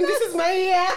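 loud hyped declaration; excited. Retold or imitated. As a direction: proclaim it, full of hype. Hyped quote of self.

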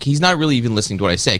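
ordinary delivery

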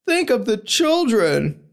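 high, slow, wailing plea; panicked church lady. Retold or imitated. Naming the Simpsons line in a high wail. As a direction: plead it like a fretting church lady. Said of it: "like, The Simpsons".

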